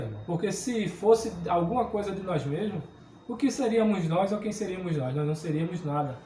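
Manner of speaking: usual speaking voice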